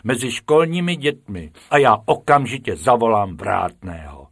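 loud, stern teacher threat. Acted. Teacher threatening the rabbits with the gatekeeper, loud. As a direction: scold and threaten. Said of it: "zvýšil hlas pan učitel".

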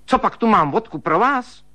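high, sharp scolding; stern. A narrator's reading. Sister-in-law scolds him for opening her cupboard, high and stern. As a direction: tell him off like a strict old maid. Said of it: "spustila přísně švagrová".

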